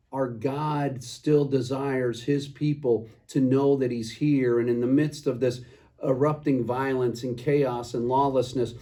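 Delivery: usual speaking voice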